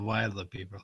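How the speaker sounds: "wilder" as WILE-der, long i; low, soft "wilder" with the vowel of "wild"; own pronunciation. Shown as a demonstration. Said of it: "I'd call it".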